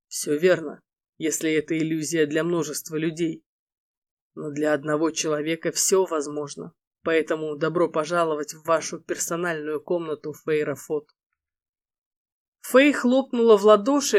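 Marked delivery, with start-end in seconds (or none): 0.1-11.1 low, soft male lead. Acted. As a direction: speak low and soft, like the husband. Эрго отвечает.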